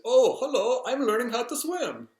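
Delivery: high, loud Indian-accented impression; bad Indian accent. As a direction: imitate an older Indian gentleman, badly. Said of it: "the worst Indian accent".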